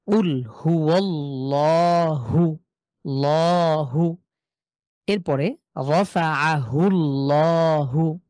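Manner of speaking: হুয়াল্লাহু and রাফাআহুল্লাহু very slowly, recited, with a thick l; heavy l recitation. Shown as a demonstration. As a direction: recite slowly, the l full and dark. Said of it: "সহজ করে আমরা লর মতন করে পড়ছি".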